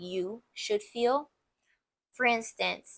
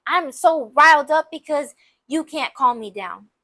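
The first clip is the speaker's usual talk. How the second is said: higher, louder acted accusation; mock agitated partner, pinning the blame; riled-up blaming; 4 semitones higher, 6 dB louder than usual. Partner blames you for their bad mood, riled up. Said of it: "They're taking it out on you".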